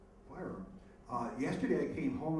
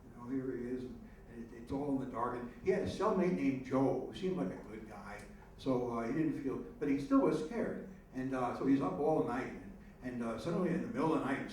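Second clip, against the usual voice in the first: usual speaking voice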